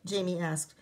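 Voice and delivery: usual speaking voice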